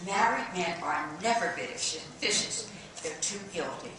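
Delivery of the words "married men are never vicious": ordinary talk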